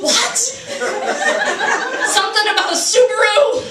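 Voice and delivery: high-pitched incredulous question; baffled disbelief. Acted. Comic confused by the song's lyrics, baffled disbelief. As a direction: cry out in mock bewilderment.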